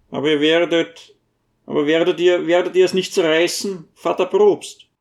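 rising worry, tumbling out fast; little dwarf fretting the priest will tear the paper; anxious dwarf. A narrator's reading.

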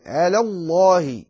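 light L in على, heavy L in الله; light lam. Shown as a demonstration. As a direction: keep على thin before الله. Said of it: "رقق اللام".

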